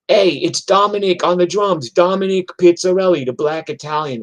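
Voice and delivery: high, loud showman announcing himself; Dominic's stage intro. Retold or imitated. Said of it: "he would be like".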